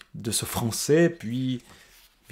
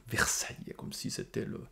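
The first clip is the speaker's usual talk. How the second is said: en aparté, le mot soufflé sans voix; chuchoté; 10 dB quieter than usual. Real, not acted. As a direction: murmure le mot pour soi. (mot répété dans un souffle)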